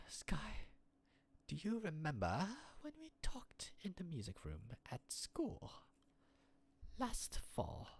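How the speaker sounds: whispering a shy memory; hushed confiding whisper. Acted. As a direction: breathe it like a secret.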